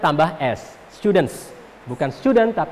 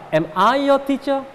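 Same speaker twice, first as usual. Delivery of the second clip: slow "Am I your teacher?" rising high; rising intonation; 8 semitones higher than usual. Shown as a demonstration. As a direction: model "Am I your teacher?" slowly, pitch climbing to the end. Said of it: "intonasinya naik". ("Am I your teacher?" pelan dengan intonasi naik)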